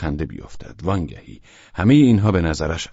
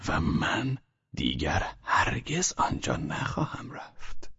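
slow, soft, half-whispered; a quiet smiling vow; with a smile; 2 semitones higher, 9 dB quieter, slower than usual. Acted. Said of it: "ولچانینوف با تبسم گفت".

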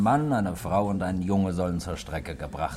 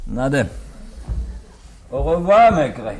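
tipsy farewell; 6 semitones higher, 9 dB louder than usual. Acted.

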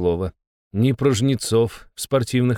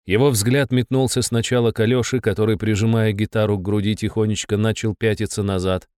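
plain reading voice

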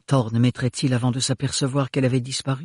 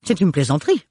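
indignant; 4 semitones higher, faster than usual. A narrator's reading. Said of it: "s'indigna le baron Melchior".

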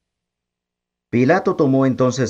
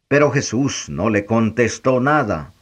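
plain reading voice

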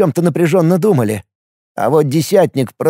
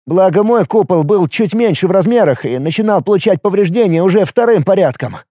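ordinary delivery

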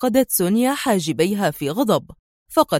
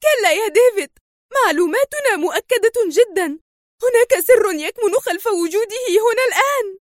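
high-pitched, agitated angry protest; angry shout; 10 semitones higher than usual. A narrator's reading. Woman angrily contradicts her colleague, crying out. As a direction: cry out in anger, pitched well up. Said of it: "في غضب وهي تصرخ".

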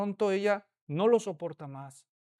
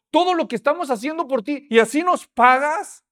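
indignant reproach; 6 semitones higher, 9 dB louder than usual. Acted. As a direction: throw their sacrifice in his face. Disciples' reproach.

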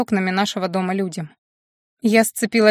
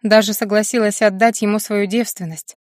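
usual speaking voice

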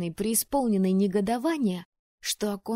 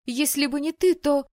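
much higher and louder, breaking off; tearful outburst; 7 semitones higher, 8 dB louder than usual. Acted. Anna accuses Weyland, nearly crying, loud. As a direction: blurt it and run out of words. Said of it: "чуть не плача заявила я".

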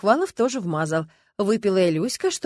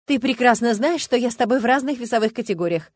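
snorting; 3 semitones higher, 7 dB louder than usual. A narrator's reading. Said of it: "фыркнула Люська".

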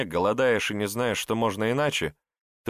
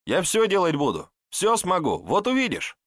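high-pitched, eager promises; eager patter; 10 semitones higher, 3 dB louder than usual. A narrator's reading. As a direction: plead your usefulness in a much higher voice. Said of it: "затараторил Ивашка". (горячо уверяя, высоким голосом)

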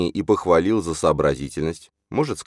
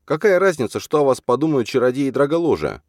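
high, louder indignant protest; 10 semitones higher, 4 dB louder than usual. A narrator's reading. Alzhana dismissing what sorcerers think of Lali, indignant. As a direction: exclaim in outraged disbelief. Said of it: "возмутилась Альжана".

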